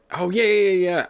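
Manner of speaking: high, loud burst of eager yeahs; light up at a friend's message; quick, loud, eager agreement; excited yeah. Real, not acted. Spotting a chat message.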